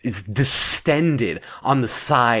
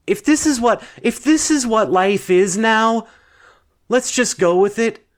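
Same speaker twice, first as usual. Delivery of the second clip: higher-pitched, giving in to it; raised voice of reluctant acceptance; worked-up resolve; 8 semitones higher than usual. Acted. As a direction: talk yourself into it, pitch held high. Resigning aloud to the strange situation.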